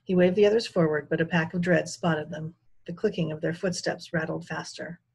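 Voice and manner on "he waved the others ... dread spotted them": ordinary delivery, nothing marked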